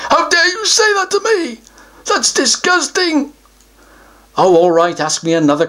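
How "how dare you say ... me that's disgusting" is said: higher, mock-offended troll voice; a troll taking the answer personally. Troll takes offence at the child's answer, mock offended.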